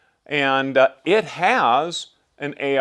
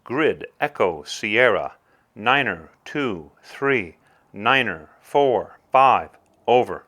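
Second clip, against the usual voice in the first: calm radio fire call; 5 semitones lower than usual. Acted. Acted radio call giving grid.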